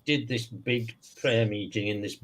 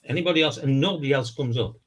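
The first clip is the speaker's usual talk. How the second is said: ordinary delivery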